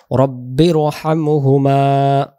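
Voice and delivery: রব্বির রহমহুমা recited slowly, with rounded o in রব্বির; slow recitation. Shown as a demonstration. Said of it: "রাব্বির হবে না". (ধীরে তিলাওয়াত)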